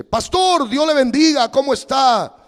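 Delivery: high, loud, urgent voice of a worried church brother; worried brother calling the pastor, high and insistent; anxious caller. Retold or imitated. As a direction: sound like a fretting caller pressing the pastor.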